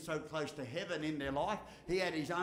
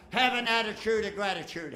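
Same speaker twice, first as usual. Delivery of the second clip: proclaimed; 3 semitones higher, 8 dB louder than usual. Real, not acted. Shouted motto to audience.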